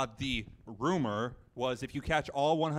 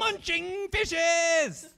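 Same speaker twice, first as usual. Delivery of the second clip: high, drawn-out outburst; exasperated high-pitched correction, long and slow; emphatic exclamation; 4 dB louder, much slower than usual. Real, not acted. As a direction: cry it out very high, stretching both words. Exclaimed correction of friend's misquote.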